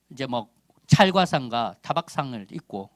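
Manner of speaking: ordinary talk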